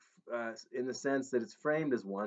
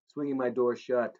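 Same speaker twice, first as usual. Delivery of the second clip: plain reading voice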